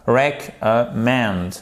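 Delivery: Recommend. drawn out slowly, stress on the end; slow stress demo. Shown as a demonstration. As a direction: stretch out Recommend. for the class. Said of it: "accent tonique ici". (Recommend. très lentement, accent à la fin)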